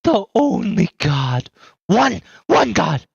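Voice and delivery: high, slow, eerie unhinged voice; raving madwoman. Acted. GM voicing the mad woman muttering about curses. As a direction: drift through the words like a lost mind.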